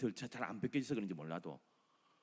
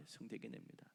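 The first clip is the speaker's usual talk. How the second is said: 성대기냅니다 repeated much softer, trailing off; soft echo; 13 dB quieter than usual. Shown as a demonstration. Preacher echoes the word quietly to the church. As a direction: murmur 성대기냅니다 back to yourself. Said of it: "야, 성대기냅니다".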